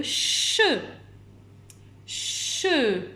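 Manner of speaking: "che" with a long shhh before the vowel; slow, isolated "che", consonant drawn out; stretched first sound. Shown as a demonstration. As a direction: hold the ch of "che" so children hear it. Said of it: "que vous entendez au début".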